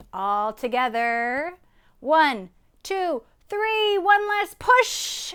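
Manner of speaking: loud, slow count rising in pitch; rallying count. A narrator's reading. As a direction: build up to a big heave. Counting the animals into a push.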